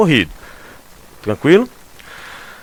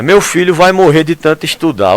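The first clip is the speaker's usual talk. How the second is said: exclaiming parent; 5 semitones higher, 6 dB louder than usual. Retold or imitated. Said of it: "frase que todo mundo quer ouvir da família".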